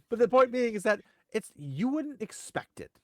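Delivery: ordinary delivery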